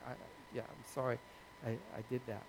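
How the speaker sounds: low, soft retold apology; sheepish apology. Team member admitting a mistake to his pastor, sheepish. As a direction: own up quietly, voice dropped.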